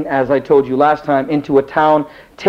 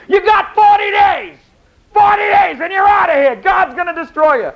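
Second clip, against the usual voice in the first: prophet yelling. Acted. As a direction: bellow the doom warning at the city. Jonah yelling at the city.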